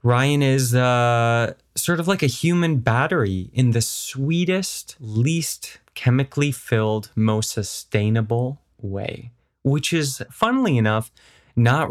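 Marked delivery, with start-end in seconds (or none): none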